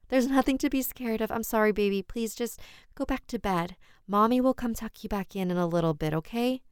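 reassuring mother. Acted. Mother soothes son.

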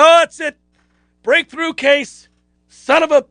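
half-hearted mock outrage at himself; mock furious. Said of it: "I was furious".

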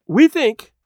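high, loud, flustered start; flurried. A narrator's reading. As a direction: blurt it out, caught off guard. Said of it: "in a flurried voice".

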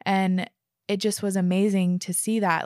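usual speaking voice

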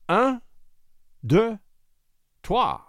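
high, spaced French count; duel count. A narrator's reading. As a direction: call each number out separately.

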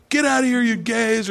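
high-pitched hollered jeer; mock heckling yell. Stranger yelling at paddleboarders, mock jeering shout. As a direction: yell it across the water, imitating. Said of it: "whatever he's yelling at us".

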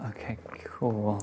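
soft unvoiced whisper. Real, not acted. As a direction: keep it to a whisper. Party sneaking, whispering together.

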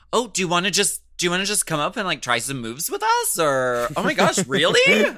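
high, bubbly, rising fake excitement; fake staged surprise. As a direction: ham up a staged invite. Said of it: "it's like".

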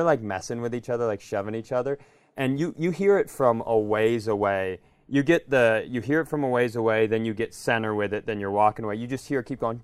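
usual speaking voice

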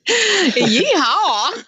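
high, drawn-out Southern cowboy whoop; mock Southern accent. Co-host teasing about the Southern accent, hammed-up Southern whoop. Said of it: "I have a Southern accent".

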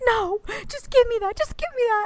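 snatch it back desperately; frantic grab. Retold or imitated. Wife grabbing the broken key from husband, frantic high. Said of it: "and I'm like".

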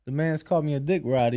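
ordinary talk

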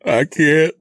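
high, loud acted sob; sobbing. A narrator's reading. Narrator refuses to watch the murder, sobbing. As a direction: choke it out in anguish. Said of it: "I sobbed".

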